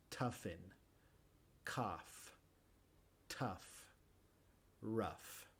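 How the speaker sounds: "toughen", "cough", "tough", "rough" with a clear f sound; soft, careful f at the word ends; English f sound. Shown as a demonstration. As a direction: show the gh said as f in "cough". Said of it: "este sonido es igual".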